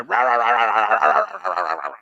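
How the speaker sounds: mock ranting noise, rising then tailing off; imitated rowdy babble. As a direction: babble rah-rah like an overheard loudmouth. Imitates drunk man's ranting from afar.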